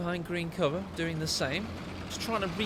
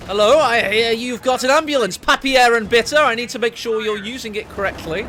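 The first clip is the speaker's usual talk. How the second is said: put-on loud, higher officious inspector, accent held back; mock German officer; 4 semitones higher, 11 dB louder than usual. Tank crew demanding to inspect the enemy ambulance, mock officious. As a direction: play a pushy officer checking the ambulance. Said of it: "I daren't do a German accent".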